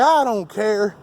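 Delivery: slow, dismissive mock critic. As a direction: play the critic who brushes it off, slowly. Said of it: "You'll probably try to flip it and say".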